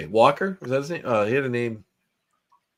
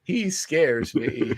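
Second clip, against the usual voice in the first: put-on very slow old-lady voice; 3 semitones higher, much slower than usual. Old lady answering her knitting friend, slow and wary. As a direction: draw it out like a wary granny. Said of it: "Just a bunch of old ladies".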